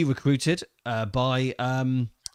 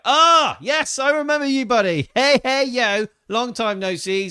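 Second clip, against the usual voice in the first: excited; 9 semitones higher, 5 dB louder than usual. Real, not acted. Greeting a returning viewer excitedly.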